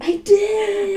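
loud high squeal of joy; excited. Real, not acted. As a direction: squeal it, thrilled. Thrilled about the trailer.

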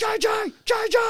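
very high, loud shouted nickname; Ryan Veach impression. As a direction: imitate a non-stop talker yelling for a teammate. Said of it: "That was a great impression from me".

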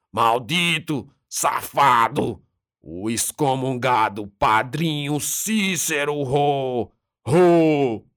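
slow guttural rant, pitched high; guttural demon voice. A narrator's reading. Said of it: "comecei com uma voz gutural".

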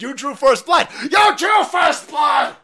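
high, very loud strained shout; mock action-hero yell. Host playing Rambo for laughs, mock furious yell. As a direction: bellow it like a hammed-up Rambo.

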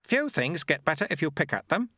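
put-on dry, knowing old monk; wry elderly sweeper monk; Lutze's voice. Lutze replies.

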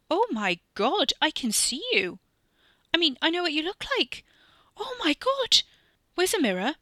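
very high, loud joyful cries; ecstatic squealing. Acted. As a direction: burst out in disbelieving delight. Katie delighted, squealing.

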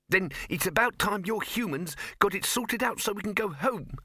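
high, sharp mouse snap; snappy squeaky mouse. Acted. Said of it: "snapped the mouse".

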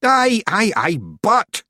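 high, urgent Scottish protest; desperate. A narrator's reading. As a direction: desperately try to get a word in. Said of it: "said Rob Anybody desperately".

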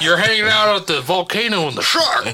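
loud laughing joke; laughing shout. Pretend.

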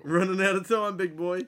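loud, high teasing jab. Real, not acted. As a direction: needle him playfully. Teasing the co-host.

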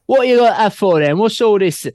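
playful fake English accent; British accent. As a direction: put on a cheeky London accent to wind him up. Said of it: "I'll put on a British accent".

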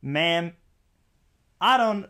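hoge, luide Amerikaan; American accent. Acted. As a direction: Amerikaanse agent spreekt mevrouw aan. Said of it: "I keep going back to the American accent".

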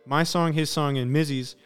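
usual speaking voice

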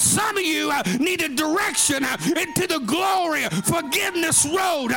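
loud, high preacher's climb; rising shouted sermon; preaching at full force. Acted. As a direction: preach it hard to the church.

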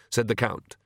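ordinary delivery

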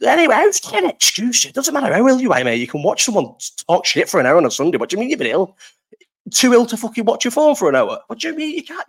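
high, loud mock outrage; play-scold her for skipping the stream; mock telling-off. Scolding viewer Emma for missing the stream, mock outrage.

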